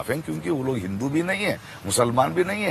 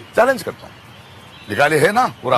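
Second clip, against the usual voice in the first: insistent challenge; 3 dB louder than usual. Real, not acted. Repeats his challenge more forcefully.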